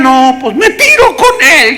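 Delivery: high, loud, drawn-out graveside wail; mock hysterical grief. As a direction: overdo the mourner's despair. Mimics relatives wailing at the grave. (lamento exagerado, agudo y alargado)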